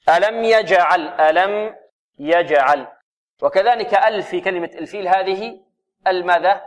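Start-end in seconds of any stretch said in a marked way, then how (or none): 0.1-2.9 slow tajweed recitation in separated words; careful teaching recitation; model recitation. Shown as a demonstration. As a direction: recite deliberately for students to copy. Teacher models verse words slowly.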